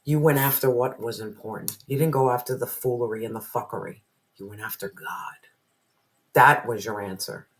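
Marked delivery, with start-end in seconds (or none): 4.4-5.4 low, whispered emphasis; hushed whisper. Real, not acted. Reader tells the viewer in a low whisper. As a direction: drop it low and soft.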